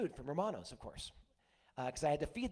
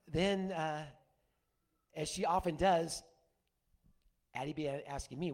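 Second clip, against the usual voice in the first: ordinary talk, nothing marked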